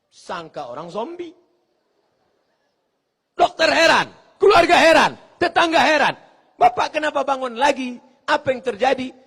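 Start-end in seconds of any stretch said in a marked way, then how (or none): 3.3-9.1 loud, high amazed cries; loud astonishment at the man waking; astonished shout. Acted. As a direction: call it out in disbelief.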